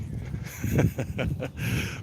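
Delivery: amused chuckle; quick run of giggles; real laughing. Real, not acted. Laughs at own bit.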